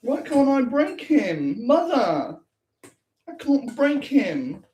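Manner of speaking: high, slow whine to mother; whiny son voice. Pretend. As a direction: play the frustrated son, pitched high. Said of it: "He's like".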